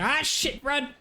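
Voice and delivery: panicked high yelling while fleeing; being chased. Real, not acted. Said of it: "let myself in game take over".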